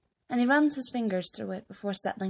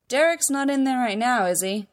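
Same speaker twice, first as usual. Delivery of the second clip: raised voice, calling across a hall; dad calling out, higher and louder; 4 semitones higher, 5 dB louder than usual. A narrator's reading. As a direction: call it casually to someone in another room. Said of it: "his dad calls from across the hall".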